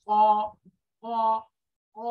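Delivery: "ক" said singly, evenly, with pauses; Arabic letter drill. Shown as a demonstration. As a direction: repeat "ক" crisply for the class. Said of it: "মোটা করে". ("ক" আলাদা করে উচ্চারণ)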